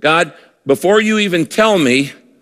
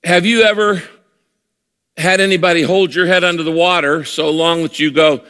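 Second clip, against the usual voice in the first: usual speaking voice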